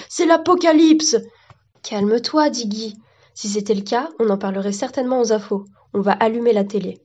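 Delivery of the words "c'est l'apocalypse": high, alarmed exclamation; melodramatic, raised-pitch panic; panicked cry. Acted. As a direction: cry out that doom has come. Panicked neighbour announces catastrophe.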